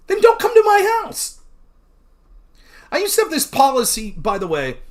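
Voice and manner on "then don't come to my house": high, loud dismissive snap; loud mock outburst at the guest; mock angry retort. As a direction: throw the guest out, half joking. Imagined retort to preachy vegan.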